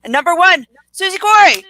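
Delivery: high, loud countdown climax; excited reveal. Real, not acted. Co-host reveals tonight's guest as number one, excited shout. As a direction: announce the top pick with a jump in pitch and volume.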